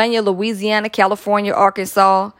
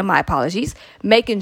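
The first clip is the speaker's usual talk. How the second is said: usual speaking voice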